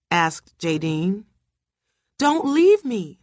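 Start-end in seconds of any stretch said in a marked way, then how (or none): 2.2-3.2 high, slow pleading cry; shrieking. A narrator's reading. Terrified wife begs not to be left, pleading shriek. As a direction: stretch it into a restrained shriek. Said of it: "shrieked Margaret".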